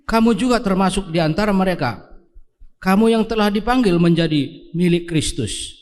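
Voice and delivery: ordinary talk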